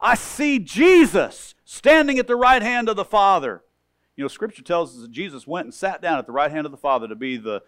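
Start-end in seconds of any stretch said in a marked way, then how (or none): 0.0-3.6 Stephen's awe. Retold or imitated. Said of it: "he looks up and he says".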